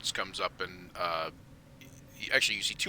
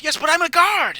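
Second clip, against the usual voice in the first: high, loud guard's rebuttal; acted exasperated insistence; exasperated retort; 15 dB louder than usual. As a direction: argue back at high pitch and volume. Guard arguing back with prisoner.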